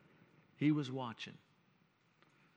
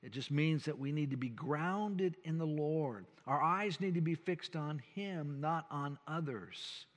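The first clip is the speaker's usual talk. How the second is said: ordinary talk, nothing marked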